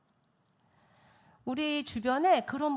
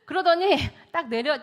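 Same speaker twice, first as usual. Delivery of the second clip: normal talking voice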